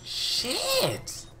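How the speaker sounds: very high, stretched squeal; frustrated squeal. Real, not acted. Gamer stuck in the game, high frustrated squeal. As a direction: let one word squeal out in real frustration.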